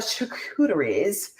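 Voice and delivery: "charcuteries" said with playful extra emphasis on the middle. Shown as a demonstration. Host showing how she'll say "charcuteries", with extra emphasis. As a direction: lean hard into the "cu" of "charcuteries", drawing it out. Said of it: "with, uh, extra emphasis".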